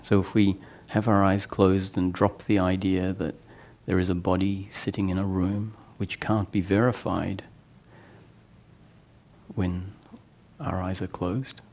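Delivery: soft meditation voice with long pauses; guided meditation. Real, not acted. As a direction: guide them gently inward, leaving long silences. Leads eyes-closed guided meditation.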